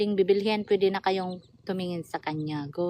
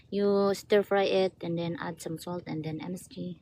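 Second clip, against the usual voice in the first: usual speaking voice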